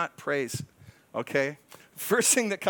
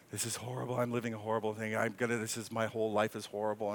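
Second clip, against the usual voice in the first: lower, softer, mopey self-pity; self-pitying moan; 6 semitones lower, 5 dB quieter, faster than usual. Retold or imitated. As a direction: wallow a little, half-mocking yourself. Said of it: "it's gonna morph into self-indulgence".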